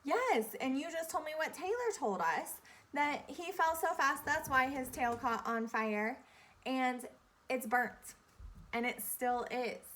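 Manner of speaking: usual speaking voice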